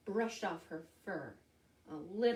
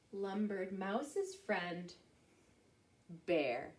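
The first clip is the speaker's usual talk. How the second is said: plain reading voice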